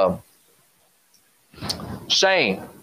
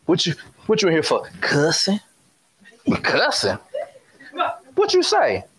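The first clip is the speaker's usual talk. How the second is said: put-on fast, stern teacher grilling a kid; mild mock teacher interrogation; teacher questioning kids; 4 semitones higher than usual. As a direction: demand an answer like a strict teacher. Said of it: "This is what I do".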